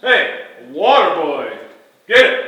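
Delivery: put-on loud, high barked order at the water boy; bossy shout. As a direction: yell down at the help like a spoiled star. [name] bossing water boy.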